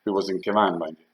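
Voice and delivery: ordinary delivery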